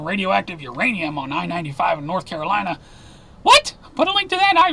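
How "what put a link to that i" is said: high, startled disbelief; incredulous. Real, not acted. Newsreader reacting to a radioactive truck story, incredulous. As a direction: let the pitch leap up in astonishment.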